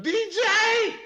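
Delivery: high, loud shout of a name; alarmed calling out. Retold or imitated. Everybody at the house calling to him, alarmed shout. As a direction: yell it across a crowded house.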